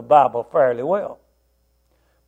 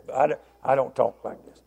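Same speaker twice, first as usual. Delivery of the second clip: much softer, in a borrowed voice unlike his own; imitating the friend's voice; 3 semitones lower, 10 dB quieter than usual. Preacher mimicking how his friend always talked, softly. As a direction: slip into his old friend's way of talking for a moment. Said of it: "he always talked like this".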